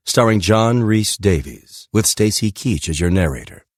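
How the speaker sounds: ordinary delivery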